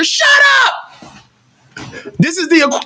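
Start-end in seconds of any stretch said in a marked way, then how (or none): 0.0-1.2 very high, loud bratty shout; high mock shriek of a bratty cousin; bratty kid yell. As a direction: snap back like a spoiled kid. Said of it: "You're like".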